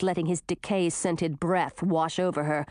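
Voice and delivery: ordinary delivery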